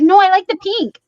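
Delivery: high, loud eager answer; eager choice. Retold or imitated. Helper picking the pink shawl, high and eager. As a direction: insist happily on the pink. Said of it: "She's like".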